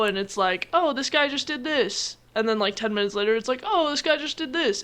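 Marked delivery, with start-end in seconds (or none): 0.7-2.2 higher, drawn-out mock realization; imagined viewer reaction. Voices a show viewer reacting. 3.6-4.8 imagined viewer reaction. Acted. Voices same viewer again later.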